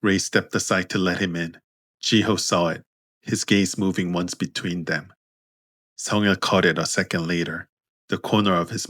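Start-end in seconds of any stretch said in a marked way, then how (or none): none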